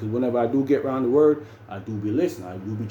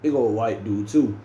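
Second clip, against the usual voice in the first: usual speaking voice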